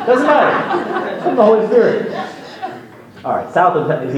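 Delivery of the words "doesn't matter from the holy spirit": laughing it off, pitched up; amused, laughing through the words. Real, not acted. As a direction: toss it off with a chuckle. Preacher laughs over denominations.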